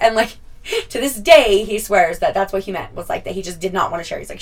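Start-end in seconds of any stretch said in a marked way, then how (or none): none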